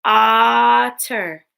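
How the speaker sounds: otter in two loud, separate beats; otter split into syllables. Shown as a demonstration. Teacher breaking words into parts with pupils, otter split in two. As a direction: stretch the first syllable of otter, then drop. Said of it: "Break words into parts".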